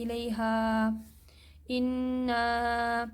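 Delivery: الیہا and انا read smoothly, the alif long and soft; gentle Quranic reading; softly without a jerk. Shown as a demonstration. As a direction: glide through the long alif with no catch. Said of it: "نرمی سے بغیر جھٹکے کے".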